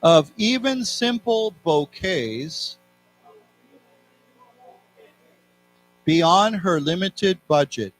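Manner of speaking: slow, evenly spaced phrases with long pauses; read-along. Shown as a demonstration. Teacher leading students through a text, slow read-along. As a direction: read slowly, leaving room for repeats. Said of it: "So let's read this together".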